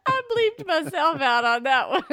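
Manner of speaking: laughing through the words. Real, not acted. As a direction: giggle out the line. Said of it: "laughing while saying it".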